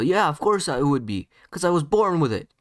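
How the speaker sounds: loud, high indignant yell. Acted. Sasuke defending his born ability, yelling. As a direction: fire back at full volume. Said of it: "Sasuke would yell".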